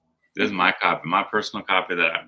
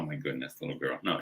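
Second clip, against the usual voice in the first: normal talking voice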